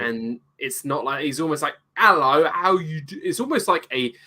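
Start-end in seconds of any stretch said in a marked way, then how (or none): 1.9-3.2 higher, posh Cockney like Kermit the Frog. Retold or imitated. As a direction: mimic Bert's odd posh Cockney, a froggy lilt. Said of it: "posh Cockney Kermit the Frog".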